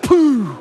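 loud puff of breath out; loud exhale puff. Retold or imitated. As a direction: blow the air out hard. Exhaling through regulator.

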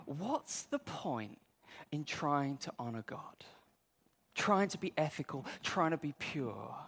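a hint of a tempting inner voice; doubting inner thought. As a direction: let the doubt creep in quietly. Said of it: "that little thought comes in".

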